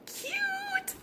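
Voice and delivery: very high squealed cute. Real, not acted. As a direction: squeal it with delight. Squealing over craft result.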